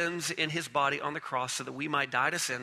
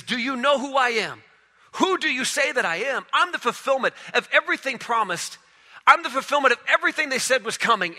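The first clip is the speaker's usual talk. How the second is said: Jesus asking, rising; 7 semitones higher than usual. Acted. Jesus challenging his disciples, high and urgent. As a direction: press them to name him. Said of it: "he looks at them and he says".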